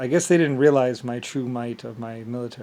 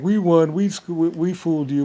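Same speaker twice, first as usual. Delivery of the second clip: mocking quote; 4 semitones higher, 4 dB louder than usual.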